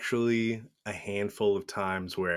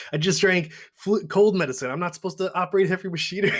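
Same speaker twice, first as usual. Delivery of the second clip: high-pitched voice, gabbling faster by the end; excuse-making Ernest; 9 semitones higher, faster than usual. Acted. Movie goof making excuses to the sheriff, high imitated voice. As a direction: reel off lame excuses in a high comic voice. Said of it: "he's just listing off all these excuses".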